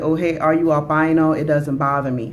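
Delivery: ordinary delivery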